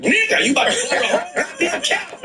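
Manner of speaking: high laughing disbelief at a teammate; cracking up while calling out a bad hit; laughing mock scold.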